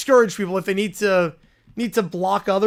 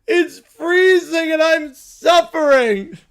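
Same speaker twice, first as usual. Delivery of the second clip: slow, louder crying complaint; mock crybaby whine; 7 semitones higher, 8 dB louder, much slower than usual. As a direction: sob about the cold, hammed-up. Crybaby character keeps whining.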